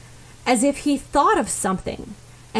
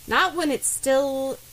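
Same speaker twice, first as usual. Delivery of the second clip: ordinary delivery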